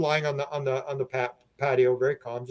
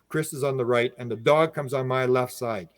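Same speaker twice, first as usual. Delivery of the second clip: ordinary delivery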